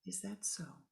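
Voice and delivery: soft, unbothered reply; calm acceptance. Acted. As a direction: accept it without protest.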